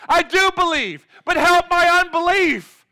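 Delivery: high, very loud anguished shriek; acted desperate scream for help; desperate crying out. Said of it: "It's a shriek. It's like crying out".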